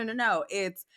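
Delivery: ordinary talk, nothing marked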